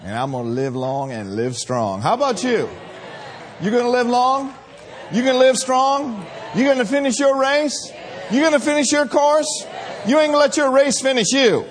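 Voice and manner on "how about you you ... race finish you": loud, high preacher's challenge, shouted; preaching at full force. Acted. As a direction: call out to the congregation, rising.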